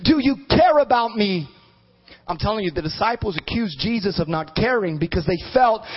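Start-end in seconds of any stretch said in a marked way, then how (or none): 0.0-1.5 high, pleading appeal to God; crying out. Retold or imitated. Preacher retelling his plea to God, crying out. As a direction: re-enact a desperate prayer, easing at the end. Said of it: "I've cried out".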